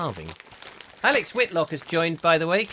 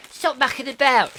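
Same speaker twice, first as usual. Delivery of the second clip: stern wife voice; 9 semitones higher, 6 dB louder than usual. Retold or imitated. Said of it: "she said".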